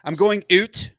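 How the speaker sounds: put-on light Canadian accent on oot. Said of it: "pronounce everything like I'm from Canada".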